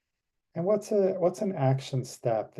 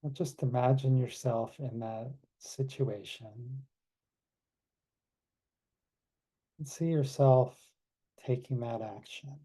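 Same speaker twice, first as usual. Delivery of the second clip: guided meditation. Acted. Leads closing meditation.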